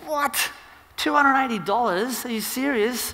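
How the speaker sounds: high-pitched mock-shocked husband; outraged disbelief. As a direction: play up the shock at the price, voice shooting up. Husband reacts to jacket's price.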